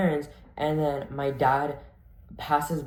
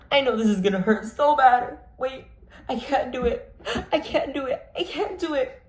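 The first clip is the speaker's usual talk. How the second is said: high, half-laughing whimper; rising high panicky I can't do it; whiny fear; 9 semitones higher than usual. Retold or imitated. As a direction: whine with mock terror. Scared of the shower rinse.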